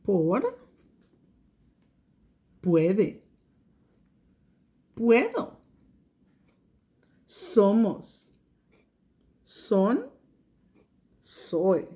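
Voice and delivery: each word read alone after long pauses; teacher reads the frequent words one by one; slow word list. Shown as a demonstration. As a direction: read them one at a time. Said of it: "ayúdame".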